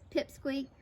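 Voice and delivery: ordinary delivery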